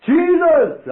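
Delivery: high, loud fervent cry; shouting Jesus. Retold or imitated. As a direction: call out the name with all you have. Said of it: "And I shouted".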